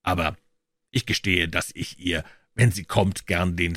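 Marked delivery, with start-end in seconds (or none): none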